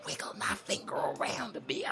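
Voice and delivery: whispered, sly throwaway punchline. Acted. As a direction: drop to a whisper for the dirty joke. Lewd punchline tossed off aside.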